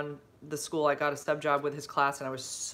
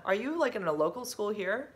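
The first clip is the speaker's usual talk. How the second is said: ordinary talk, nothing marked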